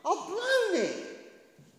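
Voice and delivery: high, loud, drawn-out lament; anguished self-reproach. Acted. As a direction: cry out at having failed. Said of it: "got to that point where he thought".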